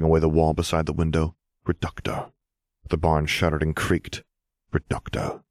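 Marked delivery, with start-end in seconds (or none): 1.6-2.3 breathy whispered incantation; whispered spell, close and tense. Acted. Spell cast again while fighting. 4.7-5.4 whispered incantation; a whispered third spell. Acted. Third spell cast while fighting.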